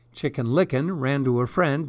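usual speaking voice

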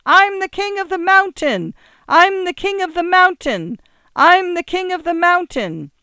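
put-on high, loud child's chant; children calling from the sidewalk, high and loud; children shrieking. A narrator's reading. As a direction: shriek it like playground kids. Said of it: "shrill voices of children shrieking".

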